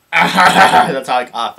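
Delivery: loud, slow laughing words; real laughter spilling over the words. Real, not acted. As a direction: laugh right through it. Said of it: "oh, I coughed".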